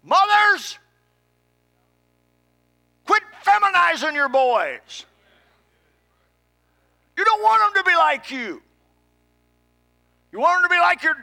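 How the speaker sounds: very high, very loud pulpit shout; full-force preaching holler; shouted rebuke. Real, not acted. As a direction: yell it at the mothers, drawing words out.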